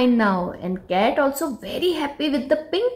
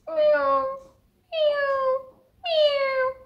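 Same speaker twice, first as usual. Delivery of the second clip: very high little mewing; sad kitten mew; much slower than usual. Acted. The sad cat mewing in the street, high small mews.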